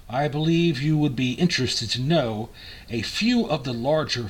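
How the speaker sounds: put-on grand, emphatic old-time ad read; promotional copy reading. Reads old promotional copy aloud.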